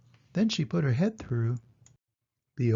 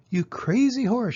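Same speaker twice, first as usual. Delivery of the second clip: higher, louder angry shout; 8 semitones higher, 4 dB louder than usual. Acted. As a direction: yell it at the horse. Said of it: "shouted the farmer".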